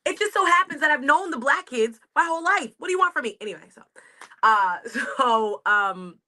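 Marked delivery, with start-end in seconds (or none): 0.0-3.4 high, louder, indignant protest; exasperated defence. Retold or imitated. Retelling her high school defence, high and indignant. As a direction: protest the accusation like it still stings. Retold defence against racism accusation.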